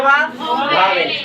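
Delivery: भावेल stretched out very slowly; teacher to child, sounding out भावेल very slowly; slow demo word. Shown as a demonstration. Teacher drills a term, drawn-out and slow. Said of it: "क्या हो जाएगा बच्चा".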